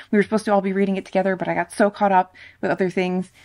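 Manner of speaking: frantic rambling. Retold or imitated. Mock frantic buddy-read excuses.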